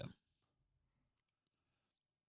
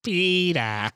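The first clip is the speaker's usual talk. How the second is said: higher, louder nagging call; Lois impression; 6 semitones higher, 5 dB louder than usual. Friends doing Lois calling Peter, louder. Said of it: "my best Lois".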